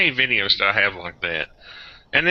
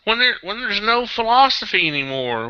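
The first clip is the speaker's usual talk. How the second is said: high, frustrated insistence; exasperated protest; 10 semitones higher than usual. Real, not acted. Host arguing the party lost its philosophy, exasperated. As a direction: keep pushing the point, voice raised in pitch.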